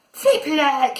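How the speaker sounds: high exclamation of pain; exasperated high shout. A narrator's reading. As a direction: shout it high, annoyed at a knock. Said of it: "he shouted".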